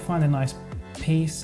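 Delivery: ordinary talk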